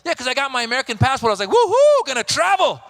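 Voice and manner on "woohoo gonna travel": very high, loud celebratory whoop; giddy whoop. Retold or imitated. Getting the American passport, high loud whoop of joy. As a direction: cheer like you just won.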